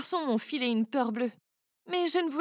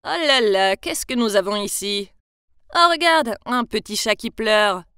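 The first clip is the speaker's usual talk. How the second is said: mocking thug; 4 semitones lower, 7 dB louder than usual. Thugs mocking a crying girl.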